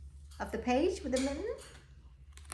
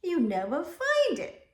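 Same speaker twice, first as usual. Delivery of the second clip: warning grandmother; 8 semitones higher, 7 dB louder than usual. Acted. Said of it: "she warned".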